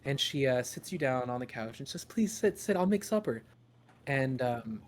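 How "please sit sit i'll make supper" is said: higher, caring mom; fussing mother. Acted. Mother offers supper.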